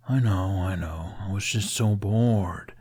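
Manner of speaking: a lightly voiced, drawn-out whine of boredom; slow, mildly whiny dragon grumble; complaining dragon. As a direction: sulk like a sleepy pet. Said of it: "he complained".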